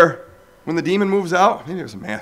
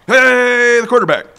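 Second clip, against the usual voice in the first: mock hero praise; 9 semitones higher, 8 dB louder than usual.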